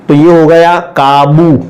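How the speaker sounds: ordinary delivery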